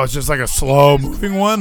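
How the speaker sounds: ordinary delivery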